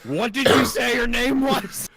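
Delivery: incredulous shout. Real, not acted. Player yells at the game master in disbelief, incredulous shout.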